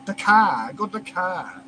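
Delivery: brief mock Chinese accent on "Dakai"; imitated Chinese accent without R's. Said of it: "they can't pronounce R's in fucking China".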